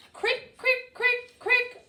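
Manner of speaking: high, light hopping clicks; high crick sound. A narrator's reading. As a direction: bounce each crick up high. Rabbit bounding sound.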